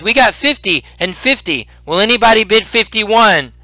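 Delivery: high-pitched auction call; auctioneer. Acted. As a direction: announce the bid to the crowd. Goblin auctioneer calling bids.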